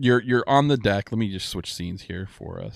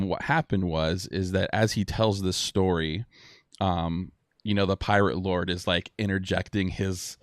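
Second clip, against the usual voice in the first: ordinary talk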